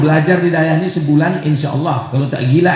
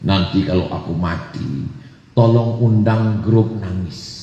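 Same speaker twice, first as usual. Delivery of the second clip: lightly acted deathbed wish, voice lowered; pesan orang sekarat; 9 semitones lower than usual. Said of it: "kalau mau meninggal dia berpesan". (berpesan lirih sebelum meninggal)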